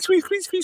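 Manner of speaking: high, soft squeak imitation; squeaky wheel noise. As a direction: mimic a cartoon cart wheel squeaking. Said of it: "So it was like, squeak".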